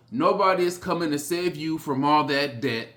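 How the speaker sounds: ordinary delivery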